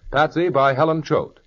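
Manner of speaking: usual speaking voice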